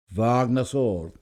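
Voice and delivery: plain reading voice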